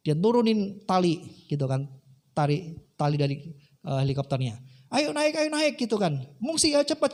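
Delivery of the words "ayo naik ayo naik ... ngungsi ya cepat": high-pitched acted rescuer's call; urgent calling. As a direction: hurry him aboard in a raised pitch. Helicopter crew urging man to climb. (seruan mendesak regu penolong)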